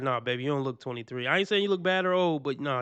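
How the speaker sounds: normal talking voice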